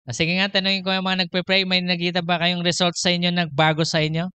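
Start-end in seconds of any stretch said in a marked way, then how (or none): none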